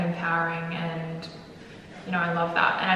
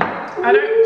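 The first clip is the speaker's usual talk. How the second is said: alarmed protest; 10 dB louder than usual. Real, not acted. Pleading not to get much sauce.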